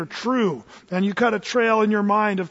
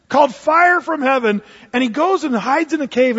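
normal talking voice

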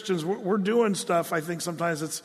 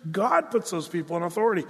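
usual speaking voice

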